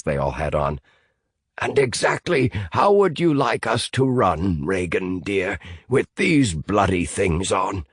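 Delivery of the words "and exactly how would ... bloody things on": sarcastic Fisk. Acted.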